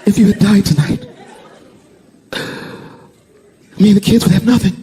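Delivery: high, tearful mock wail; imitated crying. Crying wife to husband after the attack, hammed-up tearful. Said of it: "And she's crying".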